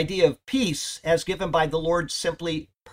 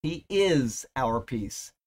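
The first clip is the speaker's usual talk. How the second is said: "is" pressed, words slow and spaced; stress on is; much slower than usual. Shown as a demonstration. Preacher driving home that he is our peace. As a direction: draw out "he is our peace", weight on "is". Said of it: "Paul's words".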